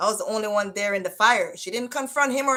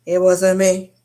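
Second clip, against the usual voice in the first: loud mock denial; 10 dB louder than usual. Teen boy insisting to his cousin, a loud mock denial. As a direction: belt it out, louder.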